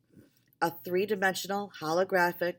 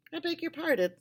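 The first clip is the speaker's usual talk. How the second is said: surprised; 12 semitones higher, faster than usual. A narrator's reading. Max startled, much higher.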